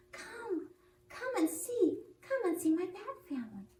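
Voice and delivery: squeaky little bat, inviting; high and eager, like a small bat. Acted. Bat invites friend home.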